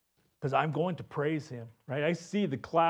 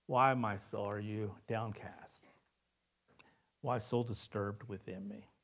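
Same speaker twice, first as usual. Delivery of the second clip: soft lament; 4 semitones lower, 6 dB quieter than usual. Acted. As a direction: let it sink low and quiet, like a sigh to yourself. Psalmist's lament read softly.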